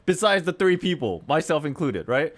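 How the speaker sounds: ordinary delivery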